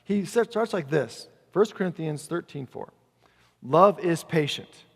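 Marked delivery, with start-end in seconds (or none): 3.6-4.9 slow, weighty scripture reading; drawn-out, deliberate verse. Real, not acted. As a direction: read it out slowly and firmly. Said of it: "I want to read it slowly to you".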